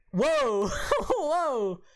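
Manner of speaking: startled laughing whoa; surprised. Real, not acted. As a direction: react to a screen glitch with a laugh. Video glitching on the call.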